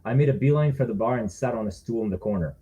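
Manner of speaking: plain reading voice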